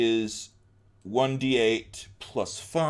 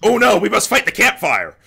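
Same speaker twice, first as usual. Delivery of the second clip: mock alarm; 7 semitones higher, 6 dB louder than usual.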